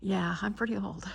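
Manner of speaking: dry joking whisper; deadpan hushed joke. Real, not acted.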